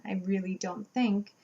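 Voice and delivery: ordinary talk, nothing marked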